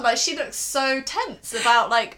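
ordinary delivery

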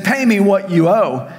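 a hint of a harsh creditor; demanding. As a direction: seize him and demand the debt. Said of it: "took him by the throat and he said".

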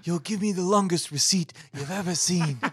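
mumbled movie godfather voice; Marlon Brando. Acted. Podcaster taking a turn at impressions, Brando godfather voice. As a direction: put on an ageing mob boss's voice. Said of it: "do Marlon Brando impressions".

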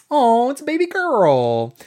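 high, drawn-out coo; mock cooing. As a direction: imitate gushing over a baby. Imitates Carrie's cooing line.

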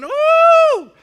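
high, very loud "woo"; excited whoop. Retold or imitated. Preacher imitates David dancing before the ark, whooping. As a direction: let out a huge celebratory whoop. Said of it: "He's, he's going".

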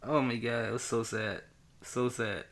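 soft, low, subdued lament; quietly saddened. Real, not acted. Fan recalling Spider-Man's death, soft sadness. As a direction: drop away softly, moved by it.

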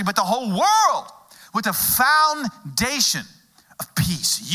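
rising fervent sermon voice; preaching. Acted. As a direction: preach it, building up. Preaching at full force.

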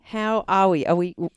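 normal talking voice

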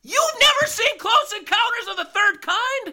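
can't believe what you just heard; shocked disbelief. Real, not acted. Host aghast that cohost never saw the classic film, incredulous.

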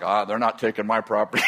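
low gruff imitation of grandpa; a lightly voiced stubborn old man; low grumbling grandpa. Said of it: "my grandpa was like".

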